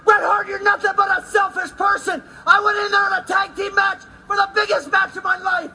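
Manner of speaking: furious wrestling promo yell; angry shouting. Acted. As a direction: scream it at your brother. Wrestler yelling at brother.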